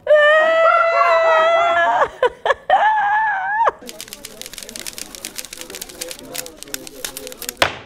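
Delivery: loud, very high cackle trailing off; real high-pitched laughing fit; shrieking laugh. Real, not acted. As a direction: burst into a long squealing laugh. Bursts out laughing at comment.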